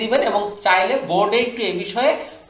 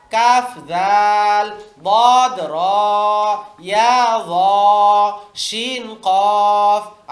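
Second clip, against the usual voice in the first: put-on very slow, drawn-out letter names for children; slow drill reading; 4 dB louder, much slower than usual. Shown as a demonstration. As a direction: spell each pair out slowly for the class to copy. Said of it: "উস্তাদ এভাবে বাচ্চাদের practice করাই দিবেন". (ধীরে টেনে টেনে হরফ পড়া)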